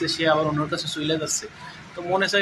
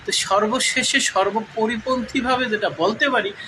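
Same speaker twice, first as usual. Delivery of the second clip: ordinary delivery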